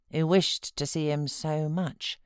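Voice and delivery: plain reading voice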